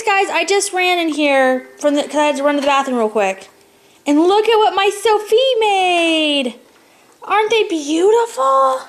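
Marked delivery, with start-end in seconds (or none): 4.1-8.8 excited. Real, not acted. Mom excited over cookies.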